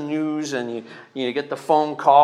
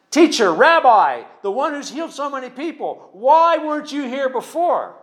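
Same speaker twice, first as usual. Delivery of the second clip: higher, louder accusing cry to the teacher; angry grief; 9 semitones higher, 4 dB louder than usual. Acted. Mary confronting Jesus, higher and louder, with grieving anger. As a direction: demand it like a hurt, let-down friend. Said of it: "she's angry".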